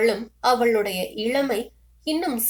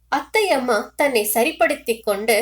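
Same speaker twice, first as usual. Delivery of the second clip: plain reading voice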